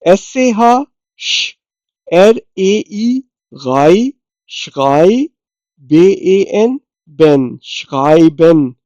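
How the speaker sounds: slow spelling then blend into the word; spelled out slowly. Shown as a demonstration. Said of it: "श्राइबन कहते हैं लिखने को".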